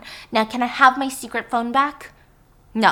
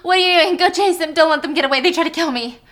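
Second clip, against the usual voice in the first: higher, louder urging; urgent shout; 6 semitones higher, 6 dB louder than usual. Acted. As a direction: push him to chase them. Helen urging Blanc to chase.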